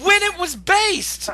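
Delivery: loud, high, slowed exasperated shout; exasperated yell. Real, not acted. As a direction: yell it in disbelief at the critic. Heated rant about a film critic.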